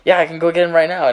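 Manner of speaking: high, loud, quick imitation of a kid; eager little brother. Little brother volunteering to fetch the games, imitated eager kid. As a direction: pipe up eagerly as a little boy, amused. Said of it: "his little brother's like".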